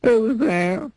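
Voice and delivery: high, thin, mournful squeak; sad squeaky voice. Acted. A miserable voice behind the door answers in a sad squeak. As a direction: squeak it out sadly through the door. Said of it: "Sad, squeaky sort of voice".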